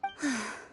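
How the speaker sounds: quiet sigh, letting it go; a soft exhale before calming down; soft resigned sigh. Acted. Speaker stops her rant.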